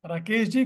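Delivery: "Rakesh जी" rising on जी like a question. Shown as a demonstration. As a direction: ask "Rakesh जी" as if checking the name. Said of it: "questioning accent".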